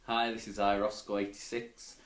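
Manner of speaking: normal talking voice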